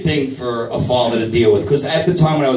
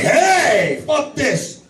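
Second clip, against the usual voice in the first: high-pitched comic outburst; mock yell; 11 semitones higher, much slower than usual. Comic heckling an angry crowd member, mock yell.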